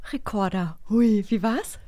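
ordinary talk

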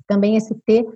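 normal talking voice

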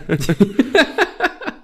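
high-pitched cackle; high laugh. Real, not acted. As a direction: burst out laughing. Laughing at joke. (hohes Lachen)

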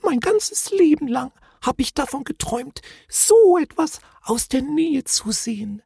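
tiny high voice full of longing; dreamy little creature. A narrator's reading. The Knütt seeing its lifelong dream, high and wistful. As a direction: sigh it out in a very high voice. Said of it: "schloss das Knütt mit einem Seufzer".

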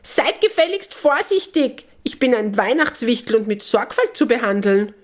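high, haughty little elf; indignant imp voice. A narrator's reading. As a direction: scold like an offended imp. Wichtel voiced in story.

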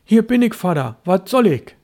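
put-on high, loud call of a young boy; boy calling back. Son answering his father from the shadows, loud high call. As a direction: shout it eagerly back to father. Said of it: "rief Störtebeker".

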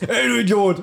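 halb gespielte Pöbelei, etwas höher; leicht überzogen wie ein Hörspielheld; nachgemachtes Anpöbeln. Retold or imitated. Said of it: "so wie Peter jetzt". (Peter nachmachen, der einen Fremden anpöbelt, leicht gespielt)

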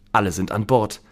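ordinary delivery, nothing marked

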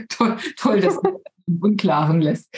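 normal talking voice

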